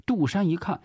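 ordinary delivery, nothing marked

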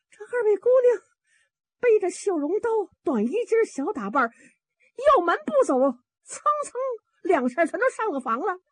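high-pitched, breathless-with-news servant; frantic maid pouring out the news; alarmed maid. Acted. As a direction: report it in a high, agitated voice.

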